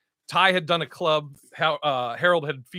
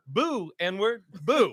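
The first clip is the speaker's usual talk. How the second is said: retold heckler's boo; 2 semitones higher, much slower than usual. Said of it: "He goes".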